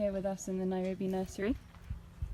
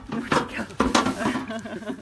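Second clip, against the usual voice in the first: playful scolding; 9 dB louder, much slower than usual. Real, not acted. Elephant stealing another's milk.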